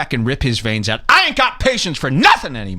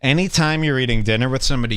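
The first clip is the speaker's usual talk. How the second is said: normal talking voice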